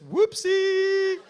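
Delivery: lightly camp oops; playful whoopsie. Retold or imitated. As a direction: tease him with a cute little oops. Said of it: "he goes".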